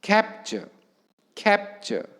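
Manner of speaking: "Capture" with a high CAP, dropping after it; stress on first syllable. Shown as a demonstration. Teacher demonstrating word to students, "Capture" stressed on a high CAP. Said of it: "hence first syllable receives stress".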